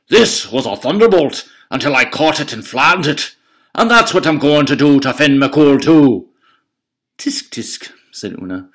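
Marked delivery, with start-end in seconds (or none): 0.1-6.3 loud, higher, boastful bellow; bully giant bragging to Una, booming. Acted. Cucullin voiced through story. 7.2-7.9 whispered tsk-tsk; mock tut-tut. Una mocking the threat.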